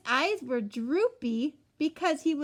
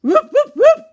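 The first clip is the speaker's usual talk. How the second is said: much higher, very loud barking; barking ever louder; noisy barking; 15 dB louder than usual. A narrator's reading. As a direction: yap it out wildly. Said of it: "and barked some more".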